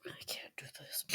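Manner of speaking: tearful breaking whisper; crying whisper. Real, not acted. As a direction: whisper through real tears. Reader overcome by sad scene.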